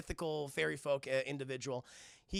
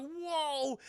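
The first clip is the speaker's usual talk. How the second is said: high, drawn-out wonder; mock amazement; 8 semitones higher than usual. Floating girl marvels at herself, acted amazement. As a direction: let out an awed, imitated whoa.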